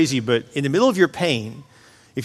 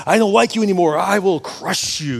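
mock menace, higher-pitched opening slowing down; mean God; 5 semitones higher than usual. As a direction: play a mean God, drawing out the threat. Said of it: "God's this mean God".

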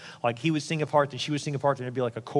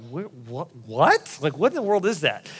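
halting stammer rising into an incredulous question; baffled disbelief. Acted. Pastor playing a spouse hearing a strange request, acted bewilderment. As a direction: stumble on the first word, then pitch up in mock shock.

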